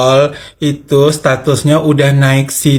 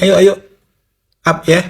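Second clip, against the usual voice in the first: urging on; 6 semitones higher, faster than usual. Real, not acted. Urging viewers to boost post.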